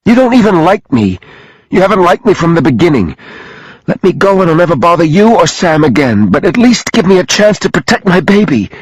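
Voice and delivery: high, distraught begging; pleading. A narrator's reading. Sophie begging for a chance to protect her baby, pleading. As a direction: plead urgently, pitch held high. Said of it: "she pleaded".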